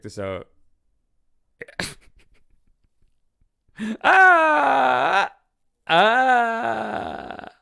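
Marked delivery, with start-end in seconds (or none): 4.0-7.5 high startled cry; shocked scream. Pretend. Character shocked you're there, loud startled cry. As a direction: yelp like someone caught off guard.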